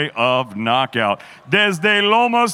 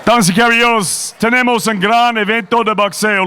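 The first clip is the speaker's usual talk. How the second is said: ring announcer; 3 semitones higher, 4 dB louder than usual. Acted.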